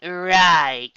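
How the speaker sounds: a dry, drawn-out sarcastic "Right"; sarcastic drawl. Acted. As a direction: let the sarcasm drag it out. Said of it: "drawled Yellow Eyes sarcastically".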